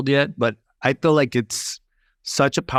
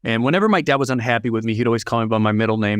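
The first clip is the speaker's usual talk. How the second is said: ordinary delivery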